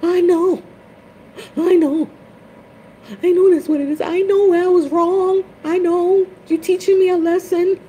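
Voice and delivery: very high, loud, breaking plea; tearful mock-wailing plea to spirit; pleading cry. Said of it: "And then I was like".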